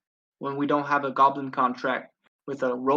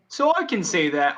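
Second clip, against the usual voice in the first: usual speaking voice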